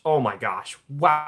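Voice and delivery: ordinary talk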